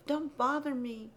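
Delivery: ordinary delivery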